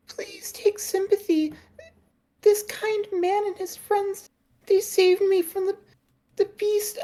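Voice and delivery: very high, faint, half-whispered plea; a frail girl begging without looking up; weak high voice pleading with the sortie. Acted. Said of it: "in a weak voice".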